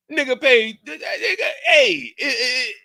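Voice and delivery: very high and loud stammering; sputtering outrage. Retold or imitated. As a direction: choke on your own fury. Sputtering rant at the bank.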